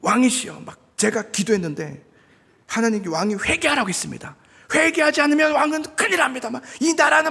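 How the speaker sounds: high, rising into a loud hammed-up rant; mock aggressive warning. Imagined Nehemiah scolding the king, hammed-up loud. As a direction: lecture the king like a prophet. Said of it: "느헤미야가 그랬다면".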